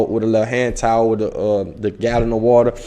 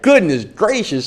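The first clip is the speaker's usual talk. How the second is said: exasperated; 9 semitones higher, 4 dB louder than usual. Retold or imitated. Overwhelmed by the long drive.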